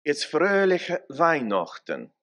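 "Fröhliche Weihnachten" in careful standard German, slowly; German greeting. Shown as a demonstration. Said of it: "when we say Merry Christmas in German".

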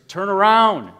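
high, loud yell to turn around; shouted plea. Retold or imitated. Minnesota fans yelling at their TVs, loud shout. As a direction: yell it at the TV screen. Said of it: "are shouting back at their".

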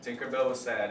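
ordinary talk

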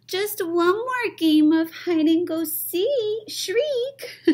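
put-on high little-kid plea; squeaky begging little mummy; pleading child. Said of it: "he pleaded".

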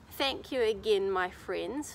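ordinary delivery, nothing marked

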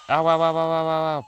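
high, loud ow cries; panicking gamer. Real, not acted. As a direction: yelp ow over and over. Taking damage, panicking.

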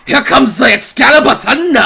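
high, excited announcer-like shout; hyped shout. Real, not acted. Streamer heralding the heroine's big retort, excited shouting. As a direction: shout it as the hype peaks. Hype peaks before a retort.

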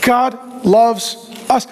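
very slow, weighty statement; slow preacher emphasis; slow emphatic declaration. Real, not acted. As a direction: let each word land. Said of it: "emphatic sermon point".

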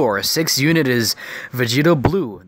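ordinary talk

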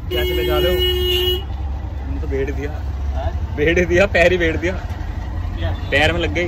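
real irritation, scolding the auto driver; snapping at the rickshaw driver over his hurt foot; angry complaint. Real, not acted.

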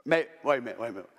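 usual speaking voice

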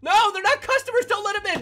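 high, loud laughing panic; panicked shout. Real, not acted. Shouting at partner as animatronics invade. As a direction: yell as they pour in.

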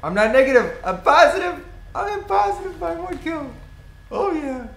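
high, loud gloating yell; excited shout. Real, not acted. Gamer cheering his positive kill ratio, loud and high. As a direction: crow it out, thrilled with yourself.

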